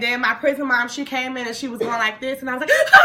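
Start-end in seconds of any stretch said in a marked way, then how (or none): 2.9-3.0 sudden mock wail; hammed-up panicked cry; imitated crying shriek. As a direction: burst out in a fake tearful scream. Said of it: "that's legit what I was doing".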